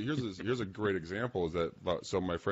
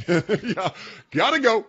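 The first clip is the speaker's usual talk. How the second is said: laughing; 13 semitones higher, 9 dB louder, slower than usual. Real, not acted. Laughing exit joke.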